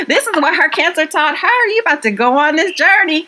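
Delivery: high-pitched, gushing mimicry; mock-enthusiastic. Friend mocking her own article about a cancer journey, mock-enthusiastic. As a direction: send up an eager article pitch.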